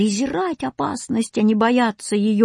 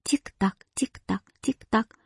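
soft, clipped-out clock ticks; ticking clock; 2 semitones higher, 6 dB quieter than usual. A narrator's reading. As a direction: imitate a clock softly ticking away. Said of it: "принялись громко отстукивать и отсчитывать время". (тихое тиканье часов)

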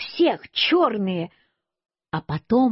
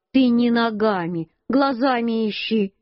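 usual speaking voice

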